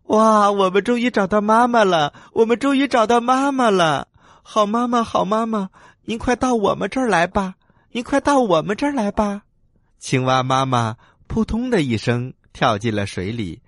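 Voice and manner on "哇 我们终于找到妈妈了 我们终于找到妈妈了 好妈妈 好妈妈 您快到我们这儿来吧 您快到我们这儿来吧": put-on high, loud childlike cheering; overjoyed tadpoles. A narrator's reading. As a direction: burst out joyfully up high, then urge mama over. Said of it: "高兴地在水里翻起了跟斗".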